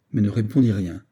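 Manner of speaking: ordinary delivery, nothing marked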